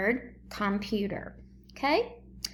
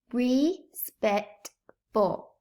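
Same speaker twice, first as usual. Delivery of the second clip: respectful broken into slow separate syllables; teacher sounding out respectful slowly; much slower than usual. Shown as a demonstration. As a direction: stretch respectful out slowly, part by part. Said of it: "Blend it".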